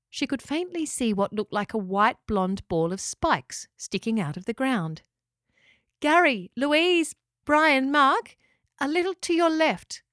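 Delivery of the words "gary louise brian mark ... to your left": high, loud directing call. A narrator's reading. Locker guides pelicans toward the stuck echidna, calling out. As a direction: call each name across a distance. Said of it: "called out Locker".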